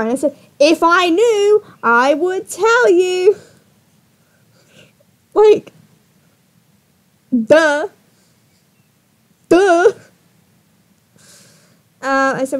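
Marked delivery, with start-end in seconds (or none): 0.6-3.4 slow, high, emphatic delivery; loud, drawn-out impatience; exasperated retort. Acted. Exasperated reply in the retold argument. 5.3-9.9 loud, high, punchy duh; scornful, isolated exclamations; mocking the obvious. Real, not acted. Mocks him over the obvious.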